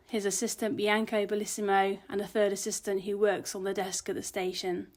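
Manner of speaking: plain reading voice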